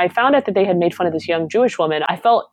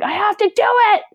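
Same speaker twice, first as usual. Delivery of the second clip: very high, urgent retold determination; fired-up resolve; 4 dB louder, faster than usual. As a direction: burst out like you've just been spurred on. Retells her fired-up resolve.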